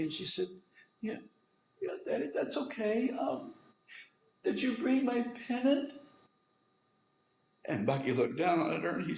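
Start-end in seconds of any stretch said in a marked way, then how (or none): 1.0-5.9 put-on high, small child's voice; little girl. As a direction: voice the sick little daughter, weak and sweet. Dying little daughter answers father.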